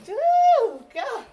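high loud yelp of surprise; a sudden shriek as the dog jumps; startled squeal. Real, not acted.

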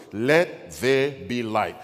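"Let there be light" very slow, one word at a time; counted-out words. Shown as a demonstration. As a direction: set each word of "Let there be light" apart, firmly. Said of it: "Four words".

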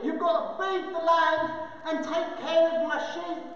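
ordinary talk, nothing marked

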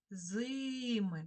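зими with a long stressed И in the first syllable; stressed, stretched И. Shown as a demonstration. Teacher giving the check word, зими stressed on long И. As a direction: draw out the И in зими, slow and clear. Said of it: "щоб цей звук чувся чітко".

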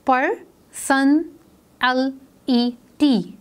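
"पर, सन, एल, ई, टी" slowly, a clear pause after each; five separate slow beats in "पर सन एल ई टी"; split into syllables. Shown as a demonstration. Teacher counting syllables in personality, syllable by syllable. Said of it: "बोलते हुए आवाज़ हमारी three times break हुई है".

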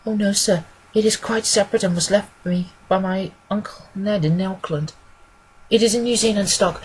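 earnest woman client. Acted. Woman telling the detective about her uncle's legacy, earnest and deferential. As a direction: explain your affairs simply and respectfully.

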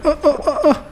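ordinary talk, nothing marked

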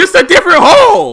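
screaming high and very loud; outraged shout. Real, not acted. Outraged yell continues.

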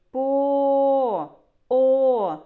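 "По, о" with a long, loud, held O; "По, о" as a sustained teaching vowel; drawn-out vowel. Shown as a demonstration. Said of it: "Ми можемо проспівати цей звук".

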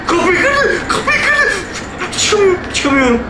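shrill high panic with a nervous laugh; panicked. Real, not acted. Gamer trapped as monster breaks door, shrill panic. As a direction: squeal in terror, half laughing.

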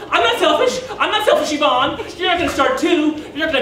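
acted mother's affronted outburst; indignant mother. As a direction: deny it hotly, stung by the charge. Mother protests his accusation.

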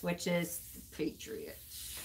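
dropped to a hushed whisper for the hated rival; a whispered aside to viewers about the Patriots; muttered aside. Acted. Streamer to fans, whispered disgust at rivals.

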